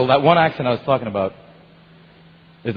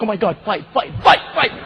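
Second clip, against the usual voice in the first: rising excited chant of fight; excited yelling; 7 semitones higher than usual. Retold or imitated. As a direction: call out the fight, thrilled.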